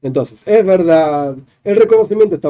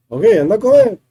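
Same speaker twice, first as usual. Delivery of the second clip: mock forcing order; 7 semitones higher than usual. Teacher imitating someone forcing a person to eat, mock commanding. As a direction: boss someone into eating. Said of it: "Como quien te fuerza a comer".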